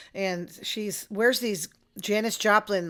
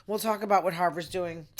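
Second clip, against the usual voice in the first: usual speaking voice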